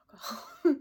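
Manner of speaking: laughing burst. Real, not acted. Joking about sitting doing nothing.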